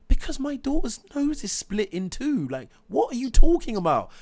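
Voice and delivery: high, loud retold outrage; imitating a high furious protest; outraged protest. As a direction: let the voice climb high and loud in indignation. Outraged father retelling confrontation.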